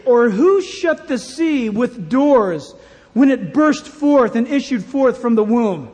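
loud, slow scripture proclaimed with force; preaching scripture. Acted. Preacher proclaiming God's words to the sea, loud and slow. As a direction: thunder out the verses of Job. Preacher reads Job aloud.

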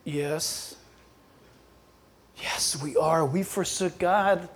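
soft, low, halting confession; subdued admission. Retold or imitated. Israel answering Goliath, subdued admission. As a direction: confess it quietly, as if ashamed.

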